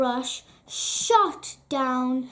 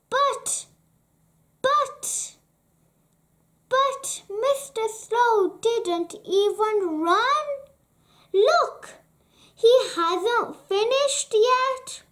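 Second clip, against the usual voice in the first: much higher, stammering outburst; flustered protest; 7 semitones higher than usual. A narrator's reading. Mister Rush protesting to the king, high and flustered. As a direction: splutter in disbelief. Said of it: "cried Mister Rush".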